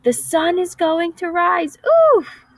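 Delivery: high, squeaky little squirrel; piped up squirrel. A narrator's reading. Squirrel piping up to the grumpy bear, high and eager. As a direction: pipe it up high like a tiny squirrel. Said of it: "the little brown squirrel piped up".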